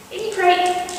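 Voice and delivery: high, sing-song command to the dog; high-pitched cue sent to the crate; bright dog cue. Real, not acted. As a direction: call it up high and cheery for the dog.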